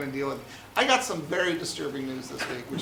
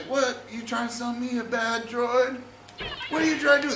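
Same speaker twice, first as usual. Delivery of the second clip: suspicious uncle; 7 semitones higher than usual. Retold or imitated. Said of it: "he's like".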